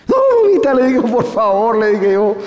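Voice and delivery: put-on very high, loud pleading little boy; begging child. Frightened boy begging his mother not to throw him, high and loud. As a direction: beg mommy for mercy.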